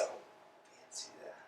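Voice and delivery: whispered, slow and doubtful; whispered aside. Acted. Doubting the man's name, a slow whisper. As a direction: breathe it under your breath.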